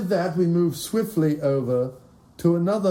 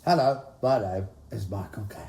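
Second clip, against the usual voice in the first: Michael Caine impression; 8 semitones lower, 3 dB quieter than usual. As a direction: do your best Michael Caine. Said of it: "cue the impression".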